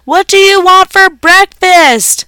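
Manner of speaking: mom yelling. A narrator's reading. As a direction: stretch it out as a full yell. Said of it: "Mom yelled".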